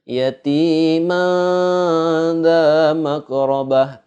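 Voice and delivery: "yatima" chanted high and slow, ending in a long veiled n; ikhfa recitation. Shown as a demonstration. Teacher demonstrates tanwin meeting dha, "yatima" with a drawn-out blurred n. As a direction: hold a masked nasal after "yatima", then chant "dha makrobah". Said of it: "Tanwin bertemu dengan huruf dha".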